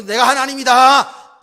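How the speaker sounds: loud, high, drawn-out proclamation; grandiose boast. Acted. Preacher mimicking someone playing God, grandiose boast. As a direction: proclaim it slowly and loudly, like a self-made god. Voicing self-deifying boast.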